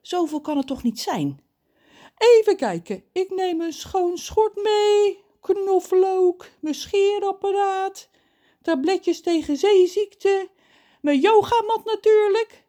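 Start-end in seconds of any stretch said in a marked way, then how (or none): 2.2-12.6 high, loud voice, drawing out his packing list; story character John. A narrator's reading. John lists what he is packing, high and drawn out.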